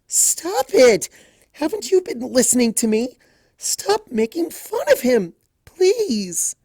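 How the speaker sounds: high loud cry rising to a plea; overwhelmed shouting plea; pleading outburst. A narrator's reading. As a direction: burst out, begging. Fluttershy's emotions took over.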